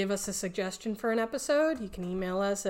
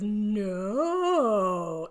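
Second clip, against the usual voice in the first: imitating contestant's no. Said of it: "She goes".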